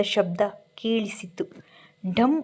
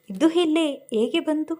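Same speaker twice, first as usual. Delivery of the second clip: higher, startled asking; surprised question; 5 semitones higher than usual. A narrator's reading. Saanvi sees her old photo in the file, a surprised question. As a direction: ask in disbelief at finding her old photo. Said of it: "ಅವಳು ಆಶ್ಚರ್ಯದಿಂದ ಕೇಳಿದಳು".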